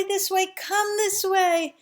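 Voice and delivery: high, slow calling across the hill; old couple beckoning refugees from the hill, high and urgent; distant urgent call. Acted. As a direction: call the runners over from far off. Elderly couple waving furiously.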